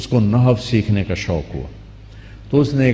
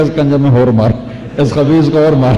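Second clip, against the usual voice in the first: louder, higher command to beat him; loud order; 4 semitones higher, 5 dB louder than usual. Retold or imitated. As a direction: bark the order to hit harder. Governor حکم دیتا ہے مارو. (زور سے اور اونچی آواز میں حکم دیتے ہوئے)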